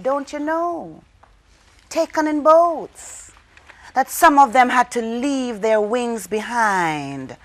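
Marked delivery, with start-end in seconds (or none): none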